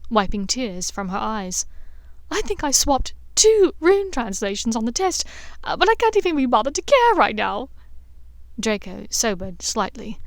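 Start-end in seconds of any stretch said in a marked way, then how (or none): 2.3-7.7 a hint of a higher girl's voice, carefree; giggly schoolgirl who no longer cares; lightly voiced Hermione, giddy. A narrator's reading. Hermione giggling with Draco after the exam, lightly voiced giddy girl.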